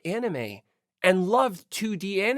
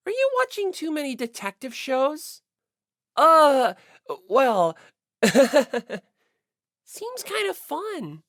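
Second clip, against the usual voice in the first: put-on higher, bright girlish voice; cheerful sporty girl; 8 semitones higher, 4 dB louder than usual. Narrator voicing Asahina shrugging off the suspicion, girlish higher voice. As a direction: play her as easygoing and sheepish.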